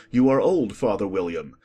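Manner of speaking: plain reading voice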